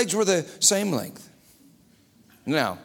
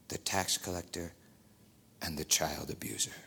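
quiet voice of God; 11 semitones lower, 8 dB quieter than usual. Acted. God answering Becca's cry, soft and tender. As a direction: speak as God, gently consoling.

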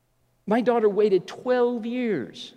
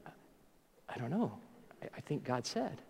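very soft, hesitant mumble; lame, halting half-whispered admission; sheepish non-answer; 9 semitones lower, 19 dB quieter than usual. Retold or imitated. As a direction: imitate someone with no answer, trailing low. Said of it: "Every story has that element".

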